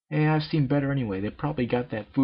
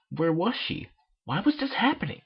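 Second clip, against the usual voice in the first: higher, rising panic; panicked; 7 semitones higher than usual. A narrator's reading. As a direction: voice climbing in fear. Panic in maze narrated.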